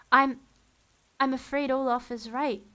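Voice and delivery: a touch of tearful halting. A narrator's reading. Justice Strauss conceding through tears, a touch tearful. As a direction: speak through tears, stumbling. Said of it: "said through her tears".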